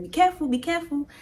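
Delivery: high, quick imitated warning; cautioning paramedic. Paramedic helping her into the vehicle, cautioning. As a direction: mimic a quick, fussing caution.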